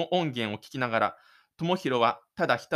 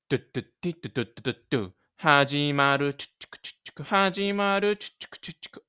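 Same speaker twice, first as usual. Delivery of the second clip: fast mouth beat with whispered hi-hat; beatbox intro; much faster than usual. Acted. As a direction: drum the intro with your mouth. Mouth-made intro music.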